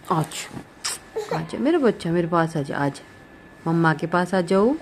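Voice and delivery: usual speaking voice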